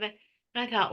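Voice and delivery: ordinary talk, nothing marked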